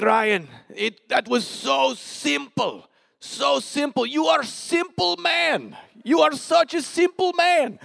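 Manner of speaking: slow, high-pitched mock Russian, emotional; [name]. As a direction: gush slowly in a hammed-up Russian accent. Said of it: "this is a terrible Russian accent".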